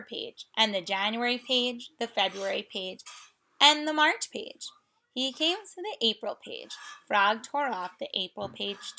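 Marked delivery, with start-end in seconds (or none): none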